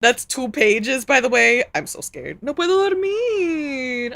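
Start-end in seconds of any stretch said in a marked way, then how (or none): 2.4-4.1 put-on whiny child.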